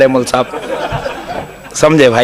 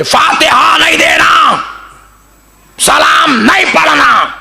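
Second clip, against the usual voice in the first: high, slow, shouted angry ban; furious high shout of a cleric forbidding Fatiha; 10 semitones higher, slower than usual. Retold or imitated. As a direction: bellow the prohibition like a furious cleric. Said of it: "गुस्से में आके बोल दिए".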